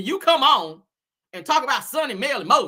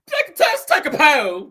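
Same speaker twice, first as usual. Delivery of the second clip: mock proper lady; 4 semitones higher, 6 dB louder, slower than usual.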